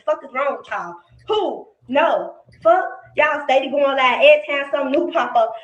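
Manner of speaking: ordinary delivery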